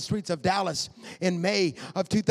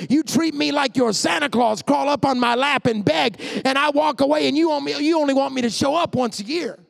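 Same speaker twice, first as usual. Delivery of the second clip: loud, high-pitched indignant preaching; full-force preaching; 7 semitones higher, 6 dB louder than usual. Acted. Preacher voices God's complaint to a churchgoer, full-force preaching.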